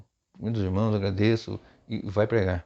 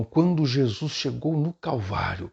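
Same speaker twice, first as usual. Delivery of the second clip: slow, drawn-out, raised dramatic oratory; theatrical preacher delivery; 5 semitones higher, slower than usual. Shown as a demonstration. Teacher demonstrating a change of intonation for the pulpit, theatrical. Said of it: "não é ficar falando com aquela".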